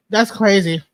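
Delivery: ordinary delivery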